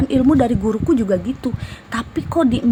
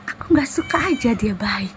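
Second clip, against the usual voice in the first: a whispered voice, not her own; creepy jinn whisper; eerie jinn whisper. Retold or imitated. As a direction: hiss it like a spirit inside her. Possessed woman's jinn answering.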